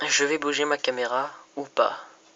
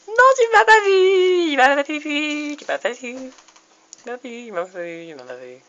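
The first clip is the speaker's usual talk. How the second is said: panicking gamer; 13 semitones higher, 8 dB louder than usual. Real, not acted. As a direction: squeal in panic then fade down. Panicked hiding from player in game.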